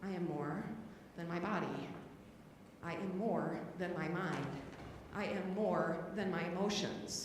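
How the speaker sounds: quiet calm self-reminder; affirmation. Real, not acted. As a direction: breathe it like a mantra.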